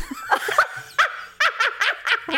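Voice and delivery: real delighted cackle; high burst of laughter. Real, not acted. Reaction to famous ass line.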